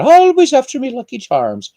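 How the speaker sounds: high-pitched stage-Irish leprechaun, loud at first; hammed-up cartoon leprechaun voice; Lucky Charms leprechaun. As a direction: leap up high, then drop, hammy Irish. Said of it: "Lucky Charms leprechaun".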